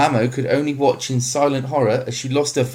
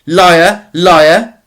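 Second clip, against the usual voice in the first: high, very loud furious cry; enraged scream; 7 semitones higher, 11 dB louder than usual. Acted. Mamo screaming at Tesfaye for lying, furious. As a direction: scream the word at him. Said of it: "screamed Mamo".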